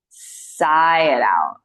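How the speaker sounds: very slow, lengthened release cue; drawn-out sigh cue. Shown as a demonstration. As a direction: stretch the words out slowly like a long exhale. Models the long sighing exhale.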